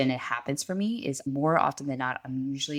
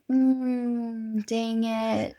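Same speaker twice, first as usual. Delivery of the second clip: high, held hum into mock frustration; playful thwarted grumble; caught out; 8 semitones higher, much slower than usual. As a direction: grudgingly concede you've been told. Acts out parent caught out.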